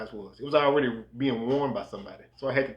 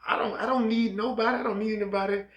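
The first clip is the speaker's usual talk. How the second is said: high-pitched dismissive brush-off; acted higher-voiced denial; defensive self-reliance; 8 semitones higher than usual. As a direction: wave off all help, voice pitched up. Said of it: "you thinking".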